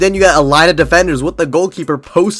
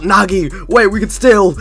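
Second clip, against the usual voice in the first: raised, louder pleading call; half-scream, urgent and acted; mock desperate scream; 5 semitones higher, 4 dB louder than usual. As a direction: imitate a character crying out to a teammate. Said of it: "Reo's screaming out".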